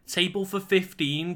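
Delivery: high-pitched child imitation; ten-year-old kid. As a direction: pipe up high like a kid ordering. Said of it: "like, ten-year-olds".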